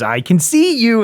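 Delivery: lightly voiced thug, high and teasing; sing-song jeer through the hole; mock taunt. Retelling the movie scene, a high mocking taunt.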